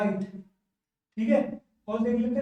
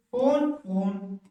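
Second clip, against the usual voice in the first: slow drill; much slower than usual. Shown as a demonstration. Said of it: "teacher drills the word phone".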